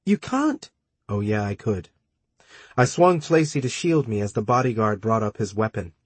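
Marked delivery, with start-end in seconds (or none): 0.0-0.7 high, shocked "you can't"; shocked cry. Acted. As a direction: cry out in disbelief. Tracy's shocked protest.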